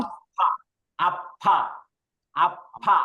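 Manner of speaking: আফা with a doubled, breathy ফ. Shown as a demonstration. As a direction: hold and release the ফ in আফা with air. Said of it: "double উচ্চারণ করেন". (আফা দ্বিগুণ জোরে ফ)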